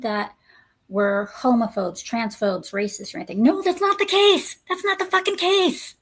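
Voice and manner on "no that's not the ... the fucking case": high, insistent objection; exasperated protest. Real, not acted. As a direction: protest in a much higher, exasperated voice. Rebutting an imagined accusation.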